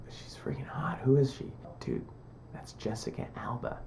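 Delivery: acting out a whispered exchange; retold awestruck whisper; hushed whisper. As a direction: whisper it like a secret between friends. Hushed exchange about a star.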